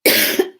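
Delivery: harsh cough on cigarette smoke; choking cough. Real, not acted. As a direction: cough it out hard. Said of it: "That went down the wrong way".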